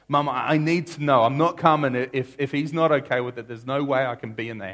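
loud, high, desperate plea; desperate son. Retold or imitated. Son begs mother, desperate.

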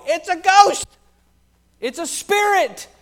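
high, loud panicked cry; frightened sailors in a storm calling out; terrified cry. Acted. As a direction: shriek it out like men seeing a ghost. Disciples see figure on water.

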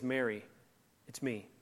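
soft and gentle, barely above a breath; tender. Acted. Jesus gently speaking to the weeping Mary, tender and soft.

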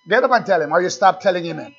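ordinary talk, nothing marked

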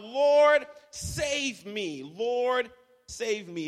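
high, loud cry for help; desperate plea. Acted. Preacher voicing sinking Peter's cry for help.

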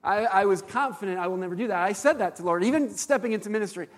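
normal talking voice

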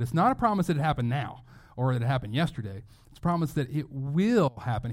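ordinary talk

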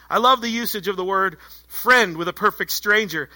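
ordinary talk, nothing marked

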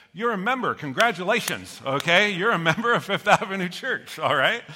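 loud, high, playful proclamation; mock-official congratulations. Pastor declares the whole congregation members, jubilant congratulations. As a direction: congratulate them big and loud with a laugh.

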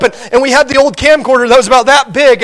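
usual speaking voice

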